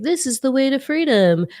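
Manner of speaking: high, proclaiming preacher voice; mock street preacher. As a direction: announce it like a zealot on the corner. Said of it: "with a sign being like".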